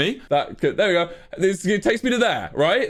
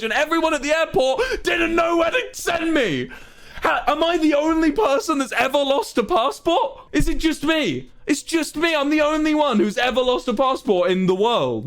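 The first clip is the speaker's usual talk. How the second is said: incredulous outraged rant; 8 semitones higher than usual. Real, not acted. Telling chat nobody else loses passports, sarcastic outrage. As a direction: rant as if you are the only one ever.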